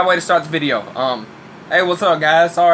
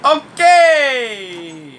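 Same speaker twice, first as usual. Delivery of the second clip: excited; 12 semitones higher, 8 dB louder than usual. Real, not acted. Loud drawn-out exclamation.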